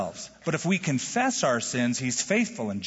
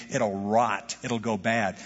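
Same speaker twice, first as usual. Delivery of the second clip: normal talking voice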